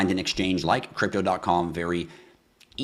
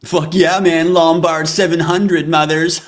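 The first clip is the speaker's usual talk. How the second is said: much higher, louder hyped-up bro voices; snowmobile bros; 10 semitones higher, 12 dB louder, slower than usual. Retold or imitated. Host imitating snowmobile bros bragging about a new sled, loud. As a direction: play two brash bros bragging about sleds. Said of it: "that's what it sounds like".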